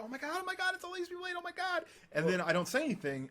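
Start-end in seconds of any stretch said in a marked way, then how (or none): 0.0-1.8 much higher squealing voice; mock fan squeal; imitating freaking-out fans. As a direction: squeal like a starstruck kid. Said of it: "they freak out".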